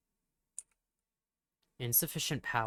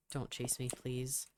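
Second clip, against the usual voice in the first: hushed, much softer plea; scared pleading; 9 dB quieter, slower than usual. Real, not acted. Player creeping away, softly begging no chase. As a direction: beg quietly not to be chased. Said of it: "I am still so scared".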